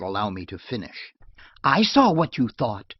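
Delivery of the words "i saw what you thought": louder, higher, bitter cry; hurt, resentful outburst. Acted. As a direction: throw the words back at him bitterly. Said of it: "she burst out bitterly".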